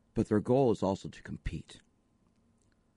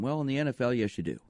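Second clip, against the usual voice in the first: usual speaking voice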